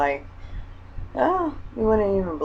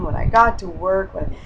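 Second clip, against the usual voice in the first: normal talking voice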